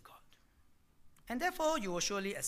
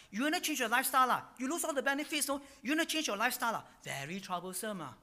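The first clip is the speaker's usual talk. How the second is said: loud, higher-pitched scare talk; mock warning; 5 semitones higher, 5 dB louder, faster than usual. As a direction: warn them off loudly, mimicking a bully. Voices Babylon warning against change.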